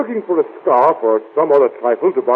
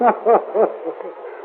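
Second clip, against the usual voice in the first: short laugh of amusement; amused laugh; 6 semitones higher, 4 dB quieter than usual. Acted. Husband amused at question about wife, laughing. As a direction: laugh at the idea.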